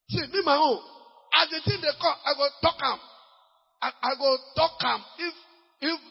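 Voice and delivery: high, loud bold boast; preacher mimics a bold prophet, loud boast. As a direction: brag that you will speak.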